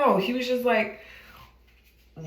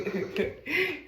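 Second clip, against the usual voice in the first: high, soft laugh; amused giggle; 7 semitones higher, 8 dB quieter than usual. Real, not acted. Storyteller amused at the club memory, soft giggle. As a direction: giggle to yourself.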